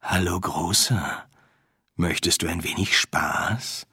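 low, slow, seductive coo; femme fatale. Acted. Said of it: "gurrte es aus der Finsternis".

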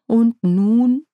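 sigh, then ask what next; seufzend. A narrator's reading. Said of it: "Nanny seufzte". (mit Seufzer fragend)

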